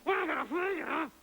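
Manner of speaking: high, garbled shout as if teeth clench a knife; muffled by knives in teeth. Acted. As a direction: mumble it round a blade in your mouth, high. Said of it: "You've got knives in your teeth".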